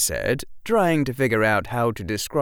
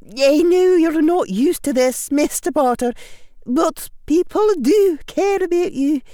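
very high, loud Scottish professor; precise Scottish accent; 7 dB louder than usual. A narrator's reading. Professor urging the boy to be kind, Scottish accent. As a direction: urge it firmly in precise, high Scottish tones. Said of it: "in her precise Scottish accent".

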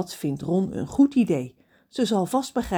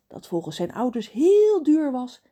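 ordinary delivery, nothing marked

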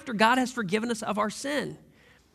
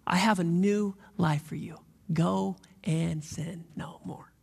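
whispered gently; 3 semitones lower, slower than usual. Pretend. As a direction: breathe the words out slowly and tenderly. Repeats Jesus' words softly.

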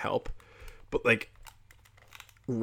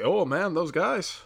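lightly voiced Putin, a bit higher; half-hearted Putin impression, musing aloud; mock crazy Putin; 6 semitones higher than usual. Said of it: "me, crazy Putin, is gonna say".

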